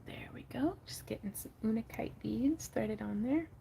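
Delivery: soft unvoiced whisper. Real, not acted. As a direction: whisper it like ASMR. Whispering while threading beads.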